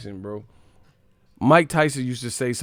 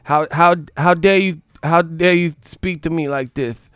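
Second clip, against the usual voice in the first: louder, indignant Tyson voice; mock outraged Mike Tyson, raised voice; Mike Tyson impression; 5 semitones higher, 6 dB louder than usual. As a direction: play an offended Tyson, loud and high. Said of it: "Mike was like".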